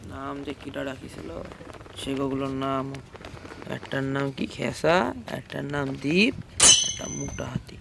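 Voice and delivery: low, soft, slow grumble; muttering to himself. Acted. Operator to himself over customers' odd names, muttering. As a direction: mull it over under your breath, deadpan.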